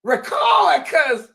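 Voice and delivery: high excited yelling; excited shout. Retold or imitated. Sister yelling for the phone, high and excited. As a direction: shout it like she can't believe it. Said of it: "these were Nitra's".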